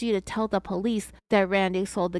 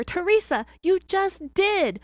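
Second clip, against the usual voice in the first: high, loud, slow exasperated agent; he can barely contain himself; exasperated retort; 10 semitones higher, 4 dB louder, slower than usual. Retold or imitated. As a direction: agent almost beside himself, slowing his words. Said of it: "almost besides himself".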